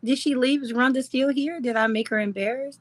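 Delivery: usual speaking voice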